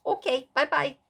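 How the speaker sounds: high, cute "bye-bye" in Mara's voice; Mara's sweet goodbye. Retold or imitated. Mara replying to the recording text, in a high, cute voice. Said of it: "Mara's the only one that said".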